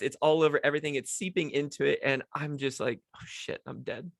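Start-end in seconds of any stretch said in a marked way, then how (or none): 3.1-4.1 much softer, breathy hushed panic; panicked whisper. Retold or imitated. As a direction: hiss it to yourself in dread. Kid panics after spilling Coke.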